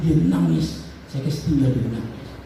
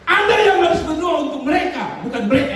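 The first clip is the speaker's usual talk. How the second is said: rebuking shout; 10 semitones higher than usual. Real, not acted. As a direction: drive the point home at full force. Preacher rebuking congregation.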